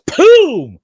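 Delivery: loud high mock pop of food flying out; woman coughing up the steak with a pop; popping cough noise. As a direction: burst it out like a cork. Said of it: "she goes".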